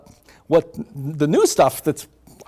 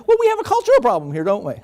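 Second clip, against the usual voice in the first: pointed question; 6 semitones higher, faster than usual. Retold or imitated. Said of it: "You say".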